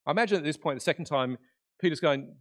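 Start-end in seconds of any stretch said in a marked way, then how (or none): none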